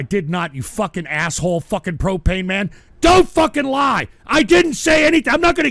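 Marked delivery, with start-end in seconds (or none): 3.0-5.7 high, loud angry denial; real outraged yelling; furious shout. Real, not acted. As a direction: yell it at the troll. Angry at a viewer's false claim.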